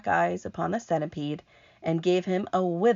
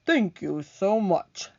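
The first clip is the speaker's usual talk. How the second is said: centipede voice; 2 semitones higher, slower than usual. Acted. Centipede character voice.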